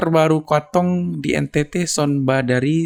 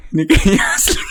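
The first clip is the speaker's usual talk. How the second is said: usual speaking voice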